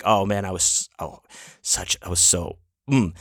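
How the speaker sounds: usual speaking voice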